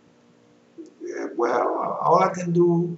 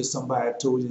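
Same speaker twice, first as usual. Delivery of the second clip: ordinary talk, nothing marked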